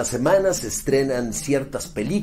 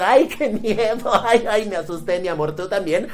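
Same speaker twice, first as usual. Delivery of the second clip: mock scared kids; 7 semitones higher than usual. Voicing scared kids mockingly.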